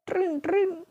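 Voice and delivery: high, light "trin trin"; imitated phone ring. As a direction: mimic a ringing phone in a high voice. Imitating a phone ring in mock scolding.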